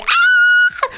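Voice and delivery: very high, loud squeal; shrill mock fangirl shriek; fangirl scream. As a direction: scream like an overexcited fan. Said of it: "I'm going".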